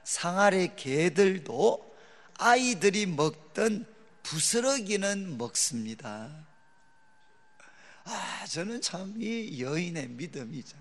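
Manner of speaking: slow, deliberate scripture quote, fading at the end; slow humble plea. Acted. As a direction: plead slowly, humbly as the Canaanite woman. Reciting the woman's humble reply.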